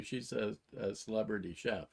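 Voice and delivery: ordinary delivery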